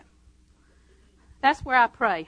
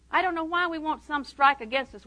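ordinary talk, nothing marked

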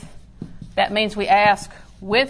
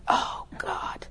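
breathy whispered groan; hushed, sinking whisper; whispered dismay; 7 dB quieter than usual. Retold or imitated. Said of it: "I went".

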